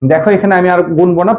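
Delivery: usual speaking voice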